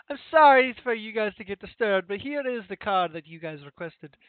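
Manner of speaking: put-on lightly accented apologetic hostess; a touch of Italian, polite and contrite. Hostess apologizing to the party, light Italian accent. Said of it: "into an accent".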